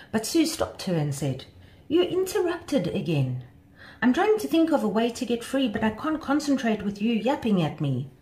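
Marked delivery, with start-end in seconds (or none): 1.9-8.1 higher-pitched, annoyed complaint; mild exasperation. A narrator's reading. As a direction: scold the friend who broke your concentration. Butterfly complains about being interrupted.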